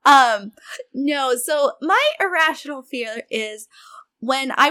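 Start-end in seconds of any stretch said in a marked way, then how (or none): none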